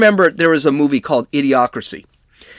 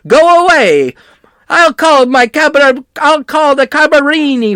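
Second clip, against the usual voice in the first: angry shout; 10 semitones higher, 4 dB louder than usual. Retold or imitated. Quoting man yelling at reporters.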